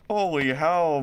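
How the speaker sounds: ordinary delivery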